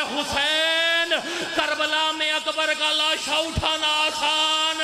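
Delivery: very slow, high lament to Husain; weeping cry. Acted. Sajjad facing Karbala, crying to Husain, very slow. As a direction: stretch the name out in a grieving wail. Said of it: "रो के कहते हैं".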